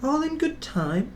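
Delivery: high whiny carriage grumble; grumbling coaches. A narrator's reading. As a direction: drag it out like sulky carriages. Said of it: "the coaches grumbled".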